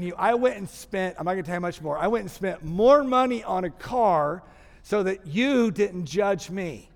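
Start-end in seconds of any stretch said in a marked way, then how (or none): 2.0-6.9 slow and emphatic. Real, not acted. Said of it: "slow emphatic confession".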